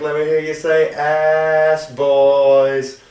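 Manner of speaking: loud, high, long-held chant lead; wrestler's crowd call. Retold or imitated. Wrestler getting the crowd to chant, imitated drawn-out call. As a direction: draw out the last words for the crowd. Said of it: "he'd be like".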